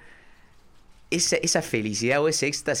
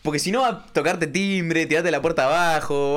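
ordinary talk

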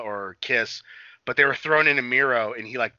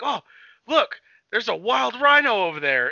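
imitating wrestler; 8 semitones higher, 5 dB louder than usual. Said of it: "he's like".

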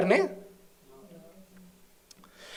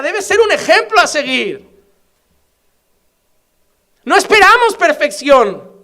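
much higher and louder, passionate exhortation; preaching at full force; 9 semitones higher, 8 dB louder than usual. Real, not acted. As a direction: preach it hard to the congregation.

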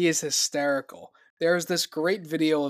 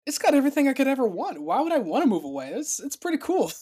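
lightly hammed-up excitement, higher in pitch; mock hype; 7 semitones higher than usual. Mocking his own old hometown hype to friends, mildly excited. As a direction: oversell the hometown like it's paradise.

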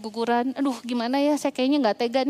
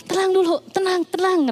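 higher-pitched, urgent soothing; acted hurried reassurance; imitated calming husband; 7 semitones higher than usual. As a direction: try to settle someone who is upset. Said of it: "Suami saya berusaha menenangkan saya".

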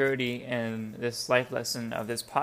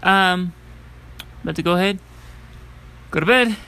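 loud tired rant; 6 semitones higher, 10 dB louder than usual. Real, not acted. Loud tired late-night rant.

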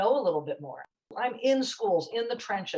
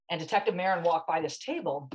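ordinary talk, nothing marked

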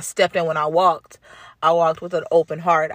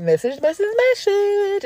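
message repeated higher each time; building emphasis; 3 dB louder than usual. Real, not acted. Stressing the messages she got, rising higher each time.

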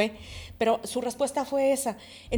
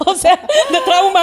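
loud, high words through real laughter; laughing; 7 semitones higher, 11 dB louder, slower than usual. Real, not acted. Mother explaining her trauma-face joke to co-hosts, laughing. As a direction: say it while cracking up, drawn out.

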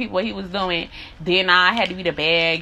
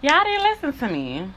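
hurt accusation; 6 semitones higher than usual. Real, not acted. Accusing listeners, emotional.

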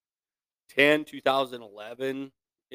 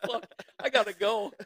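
laughing panic; 9 semitones higher than usual. Retold or imitated.

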